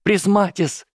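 high, panicked squeal of a spell; frightened squeal. Acted. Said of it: "перепуганно взвизгнул". (испуганный визг)

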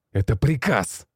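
loud, enraged order; furious bark. A narrator's reading. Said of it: "рявкнул взбешённый начальник". (яростный рык)

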